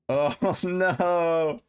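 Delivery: laughing groan of disbelief; laughing dismay. Real, not acted. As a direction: laugh through the oh no. Disbelieving laugh at his failure.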